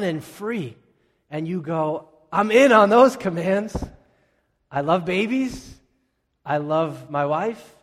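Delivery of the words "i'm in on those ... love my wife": much louder and higher, pumped up; mock eager. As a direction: ham up the eager believer. Eager believer voiced, mock enthusiasm.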